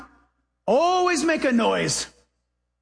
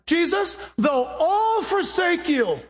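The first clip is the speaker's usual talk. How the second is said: bold Peter; 7 semitones higher, slower than usual. Retold or imitated. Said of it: "His next word".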